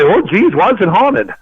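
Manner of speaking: high whiny mock homeowner voice. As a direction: whine it like a clueless homeowner. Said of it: "They wonder why".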